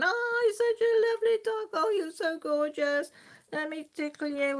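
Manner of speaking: high sing-song cooing at a dog; gushing dog-lover. Retold or imitated. Grant fussing over a stranger's dog, high mock gushing. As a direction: imitate someone fussing over a pet in a squeaky voice.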